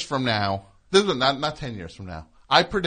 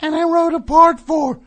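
shouting; 14 dB louder than usual. Acted. Plays loud character.

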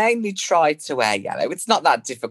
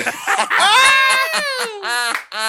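very high, loud cackle; shrieking laugh; 4 dB louder than usual. Real, not acted. Cracking up after a dig at a few duds, shrieking laugh. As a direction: burst into a piercing laugh.

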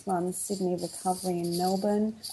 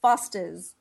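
Foster's with an Australian vowel and dropped r; an easy Australian Foster's; Aussie accent. Shown as a demonstration. Guest repeats the word for the host, Australian accent. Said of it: "Say it one more time".

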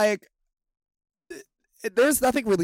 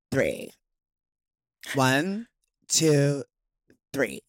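vocal fry; much slower than usual. Retold or imitated. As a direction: drag out three in vocal fry. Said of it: "It's the vocal fry".

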